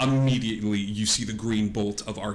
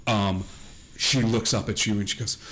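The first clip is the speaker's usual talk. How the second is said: normal talking voice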